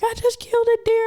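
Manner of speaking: high, hammed-up tearful confession; retold crying. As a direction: wail it like a re-enacted breakdown. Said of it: "I'm crying 'cause I'm like".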